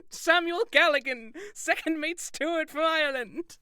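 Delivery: high, loud hammed-up death announcement; over-the-top dying cry, played for laughs; mock dying shout. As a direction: proclaim your own murder in mock agony, breaking into laughter. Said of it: "make sure to just shout".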